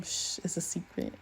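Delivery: unvoiced hushed "shh"; whisper. Payu hushing curious classmates, whispered. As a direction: whisper it like a secret.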